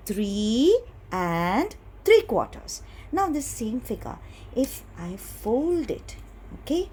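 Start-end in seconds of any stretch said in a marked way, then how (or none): 0.1-2.8 slow, drawn-out words for repeating; say-along modelling. Shown as a demonstration. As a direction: lead the class word by word, slowly. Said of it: "Say it with me".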